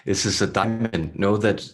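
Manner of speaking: measured, throaty channeler with long pauses; throaty channeled voice. Acted. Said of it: "they speak in such a throaty".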